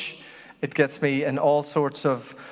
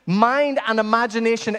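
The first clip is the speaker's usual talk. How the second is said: ordinary delivery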